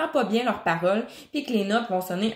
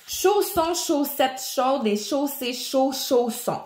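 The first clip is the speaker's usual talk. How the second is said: each word slow and carefully articulated; slow tongue twister; 4 semitones higher, slower than usual. Shown as a demonstration. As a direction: spell out the twister slowly, mouth wide. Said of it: "si j'en pogne un".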